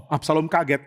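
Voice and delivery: usual speaking voice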